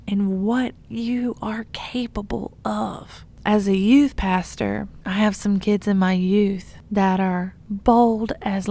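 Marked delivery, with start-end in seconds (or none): 0.1-3.2 soft whispered close. Acted. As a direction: breathe it out quietly. Sermon point, whispered.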